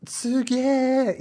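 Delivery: high, hyped-up mock amazement; hammed-up awe, drawn out and bursting; freaking out. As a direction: act out freaking out, pitch shooting up. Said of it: "just, like, freaking out".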